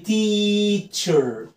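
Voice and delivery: "teacher" very slow, as "teeee-cher"; "teacher" with a high, sustained first vowel; long E demo. Shown as a demonstration. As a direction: hold the ee in "teacher". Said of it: "اعملها لونج إي".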